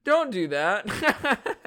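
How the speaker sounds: high, slow, fake scolding; mock scolding aunt. Aunt half-heartedly scolding the kids, slow and high. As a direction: pretend to forbid it while laughing. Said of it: "she'd be like".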